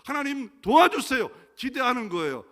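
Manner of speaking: loud, high pleading cry; crying out prayer. Acted. Preacher voicing a believer crying out to God. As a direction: call out to God in a loud plea.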